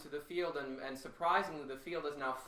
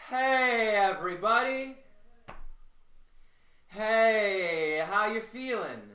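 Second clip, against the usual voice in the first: high, loud, resonant greeting; calling out; 6 semitones higher, 10 dB louder, much slower than usual. Shown as a demonstration. Voice coach greets everybody in warm-up, ringing call. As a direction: hail a whole crowd with a long-held hey.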